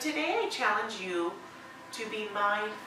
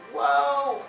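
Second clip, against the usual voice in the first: loud, higher, drawn-out whoa; amazed whoa; 5 semitones higher, 7 dB louder than usual. Acted. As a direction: let out a big whoa of discovery. Acts out noticing the calves.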